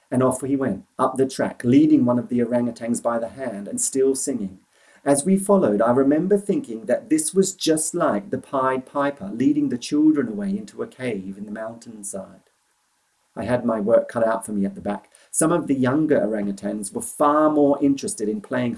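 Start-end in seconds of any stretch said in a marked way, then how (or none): none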